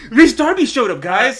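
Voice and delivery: high, loud announcement through laughter; laughing exclaim. Pretend. Telling the viewers Rhys Darby arrived, laughing loudly. Joking about a surprise guest.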